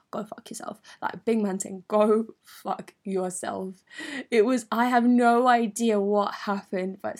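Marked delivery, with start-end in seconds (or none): 0.1-0.8 quiet, under-the-breath brush-off; muttered dismissal. Retold or imitated. Telling the boy off in her head, quiet muttered dismissal. 1.9-3.8 very slow, each word spaced out; drawn-out dismissal. Retold or imitated. Big man telling the boy off, drawn-out dismissal.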